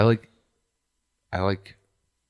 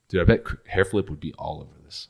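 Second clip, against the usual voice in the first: normal talking voice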